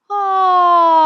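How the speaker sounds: high, loud open ah like a sigh. Shown as a demonstration. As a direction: release an open sighing ah. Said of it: "If you make an ah sound like this".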